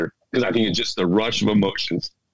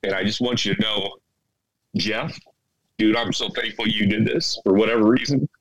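ordinary talk, nothing marked

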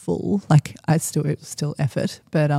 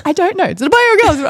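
high, loud, insistent, over and over; frantic repeated asking; 13 semitones higher, 7 dB louder, faster than usual. Retold or imitated. Mother asking her husband about the baby, frantic, high and loud. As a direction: demand an answer again and again. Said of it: "I'm like".

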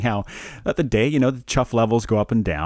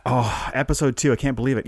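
ordinary talk

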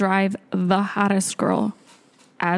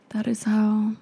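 whisper; 2 semitones higher than usual. Real, not acted. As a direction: whisper the secret to the guys. Whispered punchline.

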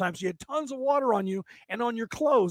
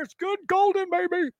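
much higher gleeful praise; high delighted squeal; 13 semitones higher than usual. Real, not acted. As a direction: sing out the pleasure. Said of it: "savoring crawfish".

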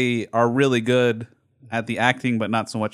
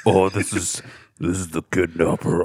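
low, halting fake kidnapper; kidnapper's distorted voice; 7 semitones lower than usual. Friend parodying the ransom call, low kidnapper voice. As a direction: drop low to disguise the ransom caller. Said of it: "distorted voice or whatever".

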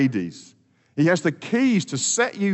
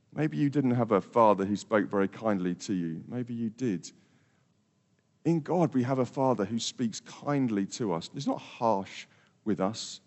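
softer, gentle words to the hurting; tender hush; 6 semitones lower, 7 dB quieter than usual. Real, not acted. Tender pastoral aside to listeners.

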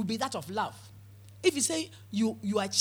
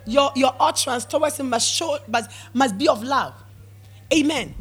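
loud, driving pulpit delivery; full-force sermon voice; fervent preaching; 5 semitones higher, 9 dB louder than usual. Real, not acted. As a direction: preach it hard to the church.

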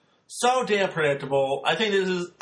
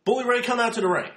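quick and simple; 5 semitones higher, faster than usual. Shown as a demonstration. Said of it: "You're like".

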